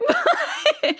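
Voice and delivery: a burst of high giggling; high laugh. Real, not acted. Telling her podcast host how she let him in the car, giggling. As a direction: crack up at herself, high and bright.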